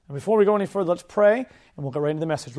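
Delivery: ordinary talk, nothing marked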